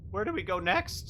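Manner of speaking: a hint of Akir asking the way; Akir's voice.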